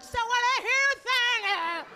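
high, slow caricatured drawl; mock the affected therapist, high and drawn out; weird-talking therapist. Therapist echoing a hopeless client, weirdly affected. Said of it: "going to a therapist like that".

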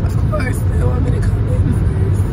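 ordinary delivery

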